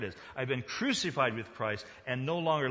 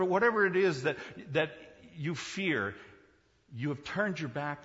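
louder, higher preaching of freedom; fervent preaching; 6 semitones higher, 8 dB louder than usual. Acted. Preacher assuring the congregation they are free, fervent. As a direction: build it with conviction.

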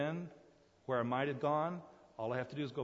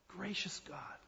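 breathy hushed whisper of praise; 6 semitones higher, 5 dB quieter, slower than usual. Real, not acted. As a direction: drop to a reverent whisper. Soft closing of a praise list.

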